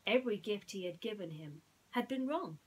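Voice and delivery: ordinary delivery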